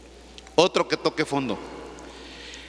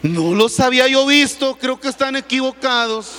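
louder, higher protest; loud defensive husband; 6 semitones higher, 5 dB louder than usual. Retold or imitated. Caught husband in the sermon story protesting loudly to his wife. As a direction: insist loudly there is a mistake. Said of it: "le dice".

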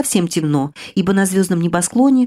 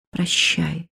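barely moving lips, a dying breath of a goodbye; faint whisper; 6 dB quieter than usual. Acted. Dying girl whispering goodbye to her beloved, faint whisper. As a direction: let the word fade out as you slip away. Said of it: "прошептали губы, едва шевелясь".

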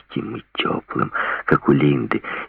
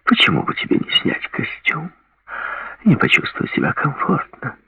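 high, breathy, coaxing purr; 13 semitones higher than usual. A narrator's reading. Lori continues purring.

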